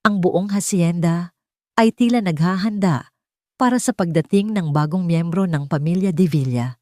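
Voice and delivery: plain reading voice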